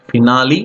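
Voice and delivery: "finale" as fi-NAH-lee, rising at the end; pronunciation ending in LEE. Shown as a demonstration. Teacher contrasting two pronunciations, this one ending in LEE. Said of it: "there are two pronunciation".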